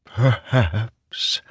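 tentative, wavering voice; trembling, uncertain. A narrator's reading. The wind interrupts the heralds' despair, trembling and uncertain. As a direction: venture it hesitantly, with a tremble. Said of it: "trembling, uncertain".

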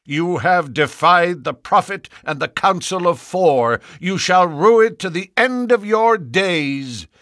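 put-on high, loud, raging young elder; furious threat. A narrator's reading. Stangerson cursing Ferrier, high and furious. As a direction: curse him in rage.

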